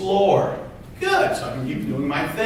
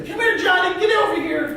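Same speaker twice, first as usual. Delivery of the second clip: much higher, sing-song mom call; mother voice; 12 semitones higher than usual. Shown as a demonstration. Mother calling her son in, high and light. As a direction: call Johnny in like a sweet mother. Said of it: "difference between a mother and a father voice".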